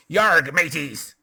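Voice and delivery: loud, slow pirate growl; pirate accent. Shown as a demonstration. New player showing the table a pirate voice, loud and slow. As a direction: ham it up like a stage pirate. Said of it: "you might do a pirate accent like that".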